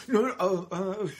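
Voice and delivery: mock hesitation; hesitant ums. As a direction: fumble for words. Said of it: "ums and ahs about it".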